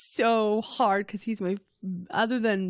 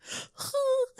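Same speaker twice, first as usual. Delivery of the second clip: excited gasp. Retold or imitated. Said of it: "everybody else is gonna squeal".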